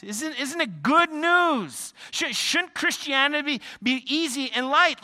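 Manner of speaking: high, loud preaching crescendo; rising preaching. Acted. Preacher pressing the congregation, rising full force. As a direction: build the sermon to full force.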